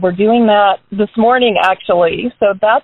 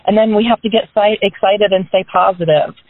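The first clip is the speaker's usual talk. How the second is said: normal talking voice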